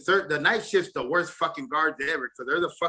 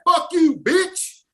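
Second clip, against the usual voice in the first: high, loud mock cussing; defiant retort; 14 semitones higher, 5 dB louder, much slower than usual. Inmate cussing back at the night guard, high defiant mock yell.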